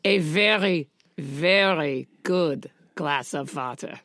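put-on German accent. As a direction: savour it in a comic German accent. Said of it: "go back to my German accent".

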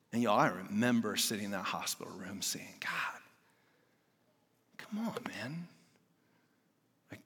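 near-whispered plea to God; hushed prayer. Retold or imitated. Father praying over his sick baby, hushed and pleading. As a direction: whisper it like you're alone in a hospital room. Retold hospital room prayer.

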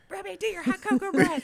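high loud call across a crowd; hammed-up embarrassing parent shout; mock public yell. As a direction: holler at the kid in public. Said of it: "yell that and you're, like, in a public space".

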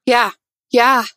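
ordinary delivery

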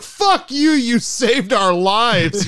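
high, loud mock fury; super mad. Scientists cursing the podcasters who saved their lives, mock furious. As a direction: drag out the insults in hammed-up outrage. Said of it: "they're, like, super fucking mad".

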